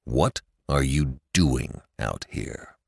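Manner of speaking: a suspicious father questions his daughter, tense and low; tense and strained. A narrator's reading. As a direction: hold back tension, slow and low. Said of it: "His voice was tense and strained".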